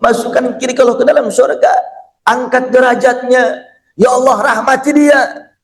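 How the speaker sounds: lightly acted mourning prayer; tearful prayer. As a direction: plead to God as a grieving companion. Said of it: "semua nangis".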